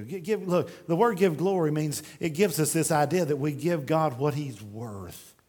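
ordinary talk, nothing marked

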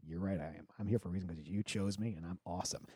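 low, soft mock self-satisfaction; arrogant. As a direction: imitate a cocky drummer quietly agreeing. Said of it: "Andrew is very arrogant also".

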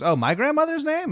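put-on high-pitched little girl voice. Little girl in red greeting the new neighbor, high voice. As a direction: pitch it way up like a young child. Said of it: "little girl".

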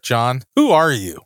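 normal talking voice

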